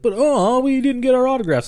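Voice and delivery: put-on high, whiny kid voice; disappointed kids. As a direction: whine it like let-down children. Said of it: "these kids are like".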